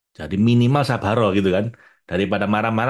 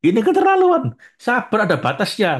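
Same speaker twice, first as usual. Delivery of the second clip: much higher and louder exasperated cry; mock outburst; 13 semitones higher, 5 dB louder than usual. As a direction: burst out in hammed-up outrage. Patience snapping, mock outburst.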